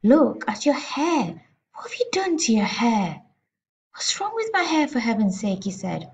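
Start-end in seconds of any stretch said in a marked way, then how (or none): 0.0-3.2 shrieking. A narrator's reading. Mother aghast at her husband's hair, shrieking. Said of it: "the mother was shrieking". 3.9-5.6 starts very high, then drops; indignant question. A narrator's reading. Father answering his shrieking wife, indignant.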